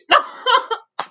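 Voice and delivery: loud, high cackling laugh; real laughter. Real, not acted. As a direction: burst out laughing.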